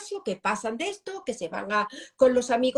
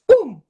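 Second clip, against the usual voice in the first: a sudden loud mock "pum" punch noise; mock punch effect, much louder; loud punch sound; 5 semitones higher, 12 dB louder than usual. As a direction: smack the air with a punch sound. Imitating a punch.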